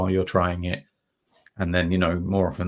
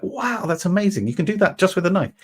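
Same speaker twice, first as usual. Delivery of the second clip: mock amazement; 11 semitones higher, 5 dB louder than usual. Amazed at knife carving.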